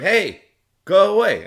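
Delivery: loud high yell to shoo them; angry shout. Retold or imitated. Shouting at crowd.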